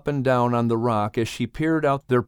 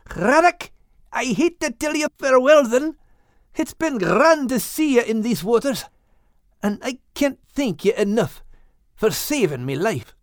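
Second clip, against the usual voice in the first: put-on high Scottish-accented voice; high, fond Scottish accent; fond Scottish farewell; 10 semitones higher than usual. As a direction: Max saying goodbye to the whale. Max Scottish voice.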